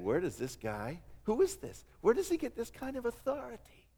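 soft, awed question from the boat; hushed awe. Retold or imitated. Preacher voicing awed disciples, quiet wonder. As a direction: hush it like frightened disciples.

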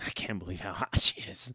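whispered aside; whispered awe. Acted. Host whispers admiration of a wrestler to his co-host, whispered. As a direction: whisper it low, almost no voice.

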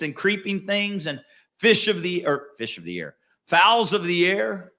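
high, ringing pulpit reading of the verse; fervent scripture reading; preaching scripture. Acted. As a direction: read it out to the whole church. Reads scripture at preaching force.

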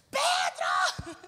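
sharp exasperated shout of a name; shouting his name. Retold or imitated. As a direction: yell for the kid across the house. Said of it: "Eu gritei mais uma vez".

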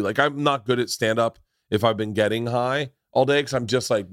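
ordinary talk, nothing marked

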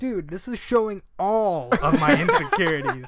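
laughing complaint. Retold or imitated. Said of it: "I'm like".

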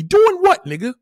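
high, loud burst of disbelief; incredulous retort. Real, not acted. Streamer scoffs at caller's son story, incredulous. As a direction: throw it back in loud disbelief.

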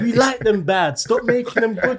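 high, laughing skit delivery; playing the skit guy, giggling; skit character voice. Replaying his skit lines.

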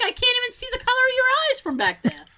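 high, fast teasing voice; high fast sarcastic comeback; mock retort. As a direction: rattle it off, high and mocking. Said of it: "It's like".

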